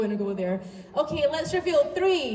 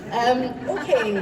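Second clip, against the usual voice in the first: normal talking voice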